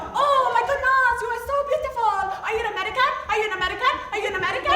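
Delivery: high, loud, badly faked French accent. Hopping Frenchman approaching an American woman, bad French accent. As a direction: gush as an excitable Frenchman with a shaky grip on the French accent. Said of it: "I can't do a French accent".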